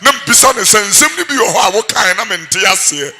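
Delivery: loud impassioned sermon delivery; full-force preacher cadence; fiery preaching. Acted. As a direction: preach it hard to the crowd.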